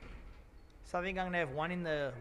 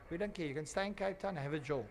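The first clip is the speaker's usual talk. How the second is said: ordinary talk, nothing marked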